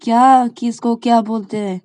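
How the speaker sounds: high, loud puzzled demand; pressing question. Acted. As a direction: demand that she finish the thought. Shreya presses the stammerer.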